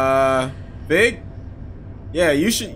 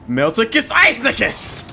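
play-acted wizard cry, slow and raised; hammed-up, high-pitched incantation; mock spell incantation; 5 semitones higher, slower than usual. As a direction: cast the silly spell with mock grandeur. Casting a made-up game spell.

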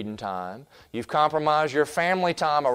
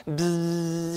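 soft imitated fly buzz; buzzing fly sound; 3 semitones higher, 6 dB quieter than usual. Preacher imitating the fly buzzing past, soft buzz. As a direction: buzz it softly like a passing fly.

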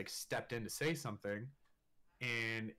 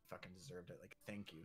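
hushed confiding; 7 semitones lower, 15 dB quieter than usual. Retold or imitated. Girlfriend's mom voiced confiding.

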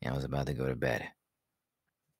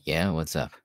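ordinary talk

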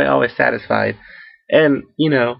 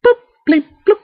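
high, loud electronic boop-bleep-bloop; beeping glitch noises; 6 dB louder than usual. Retold or imitated. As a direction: squeak out the glitch like a broken stream. Mimicking the stream glitch sound.

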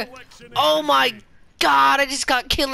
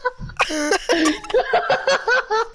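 high laugh; 9 semitones higher than usual. Real, not acted. Laughing at clip.

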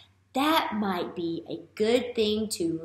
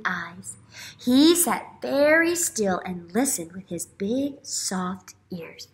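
put-on reading to children; 5 dB louder than usual. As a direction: read it aloud to wide-eyed kids, slowing on each guess. Reads picture book aloud.

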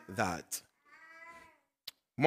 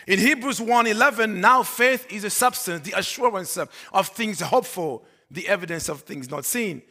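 raised, emphatic scripture reading; preaching; 7 semitones higher, 8 dB louder than usual. Acted. Pastor quoting Hebrews to the congregation, preaching. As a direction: declare the verse loud and high.

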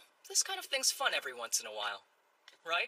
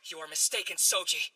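ordinary talk

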